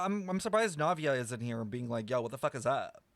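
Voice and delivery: ordinary talk, nothing marked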